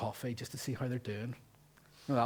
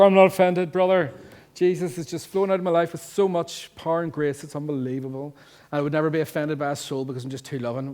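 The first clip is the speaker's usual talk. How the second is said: loud, over-sweet holier-than-thou; mock pious boast; 4 semitones higher, 7 dB louder than usual. Preacher mocks believers denying offense, loudly pious. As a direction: oversell how loving you are.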